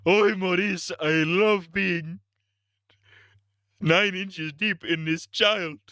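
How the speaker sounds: high, slow cartoon king impression; King Julian. Cartoon king confiding in his aide, high Julian impression. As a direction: stretch it out in a put-on cartoon accent. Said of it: "What if King Julian was".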